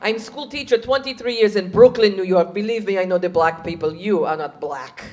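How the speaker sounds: put-on soft, condescending Hungarian accent. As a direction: correct her gently in a Hungarian accent.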